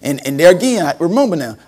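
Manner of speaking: normal talking voice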